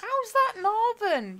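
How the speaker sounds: high, loud, drawn-out challenge; indignant disbelief. Real, not acted. Challenging friend over a northern word, indignant disbelief. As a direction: stretch it out, incredulous and loud.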